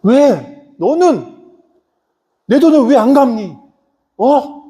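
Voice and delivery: high-pitched angry king demanding his money. Acted. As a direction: act out an indignant king, pitch jumping up. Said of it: "임금이 화가 나지". (화가 나서 높은 소리로 따지는 임금)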